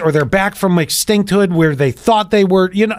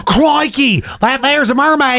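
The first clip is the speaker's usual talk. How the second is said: put-on gasping, higher Australian accent; 6 semitones higher than usual. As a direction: gasp then Crikey in an Australian accent. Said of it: "Is that an Australian accent?".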